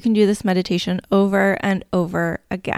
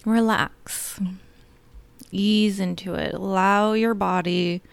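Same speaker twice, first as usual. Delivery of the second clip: guided meditation. Acted. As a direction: lull them gently into calm.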